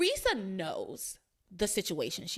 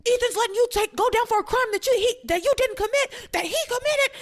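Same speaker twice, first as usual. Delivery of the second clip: high, loud disbelieving cry; imitating Luis, shocked and much higher; incredulous outburst; 13 semitones higher, 6 dB louder than usual. Luis shouting at Teresa in disbelief, high and loud.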